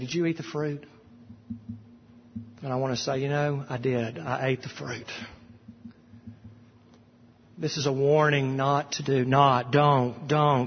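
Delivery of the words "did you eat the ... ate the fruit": soft, hushed admission; quiet confession. Acted. Preacher admitting to God he ate the fruit, hushed. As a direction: own up almost under the breath.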